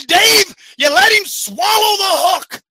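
an exasperated father yelling at his son; angry dad scolding. Retold or imitated. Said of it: "He said".

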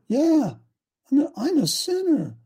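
lightly voiced upset little boy, pitched high; imitating a tearful child. Six-year-old grandson confessing to his mother, imitated tearfulness. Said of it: "and he's crying".

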